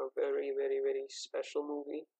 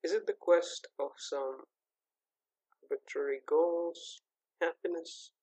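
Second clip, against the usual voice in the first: slow, thoughtful intro narration with long pauses; reflective voiceover; slower than usual. Acted. Opening the movie podcast, a slow reflective voiceover. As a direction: muse aloud as if opening a documentary.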